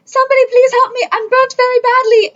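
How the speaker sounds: high-pitched plea for help, played for comedy; Austin Powers henchman. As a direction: imitate a burned henchman calling out. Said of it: "he said it in the same tone".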